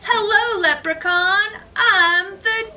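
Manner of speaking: triumphant shout. Acted. Gingerbread boy catches the leprechaun in his trap, loud and victorious. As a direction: crow it like you've won.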